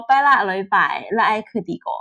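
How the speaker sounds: 用潮州话说“拜啦雷拜，啦爱克迪狗”; “拜啦雷拜，啦爱克迪狗”按潮州话家常口音念; teochew dialect. Shown as a demonstration. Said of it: "就是我们说". (用潮州话念出“拜啦雷拜，啦爱克迪狗”)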